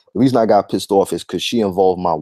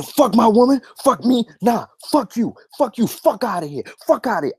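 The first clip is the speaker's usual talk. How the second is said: pitched-up, heated shouting at her; 13 semitones higher than usual. Retold or imitated. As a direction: replay the moment you went off on her. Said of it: "I started shouting".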